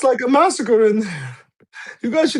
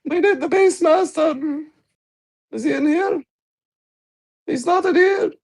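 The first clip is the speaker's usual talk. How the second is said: higher calls down the corridor; calling out; 7 semitones higher than usual. Acted. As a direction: call out for the Beast Master. Calling through corridors for someone.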